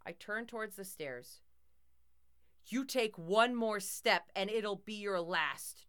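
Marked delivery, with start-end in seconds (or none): 2.7-5.9 slow, booming threat; bellowed threat. A narrator's reading. Toy threatens intruder on the stairs, slow bellowed threat. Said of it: "Jock bellowed".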